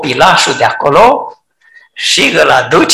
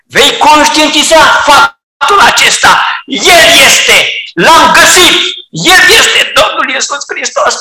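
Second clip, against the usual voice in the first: fervent preaching; 6 semitones higher than usual. Acted. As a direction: preach it with full force. Preacher proclaims.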